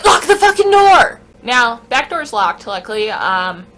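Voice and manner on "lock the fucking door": high, very loud urgent scream, acted; frantic all-out warning yell; panicked yell. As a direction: shout the order as if lives depend on it. Panicked group yelling at driver.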